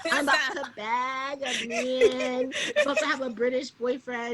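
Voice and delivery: hammed-up giddy boast; giddy younger self. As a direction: crow it giddily, stretching the words. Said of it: "my twenty-year-old self was like".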